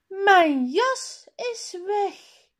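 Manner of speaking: high, loud cry of alarm; child calling out in dismay. A narrator's reading. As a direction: shout it out as a boy who lost his coat. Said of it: "roept hij".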